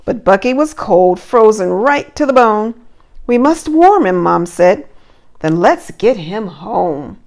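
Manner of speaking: lively rhyming storybook read; reading to children. Acted. Teacher reading a Christmas story to her class, lively storybook voice. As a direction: read the verse aloud with bounce for little ones.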